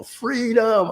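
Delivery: high, drawn-out cry of freedom; mock Braveheart shout; Braveheart yell. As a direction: belt it like a battle cry. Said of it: "just wanted to yell".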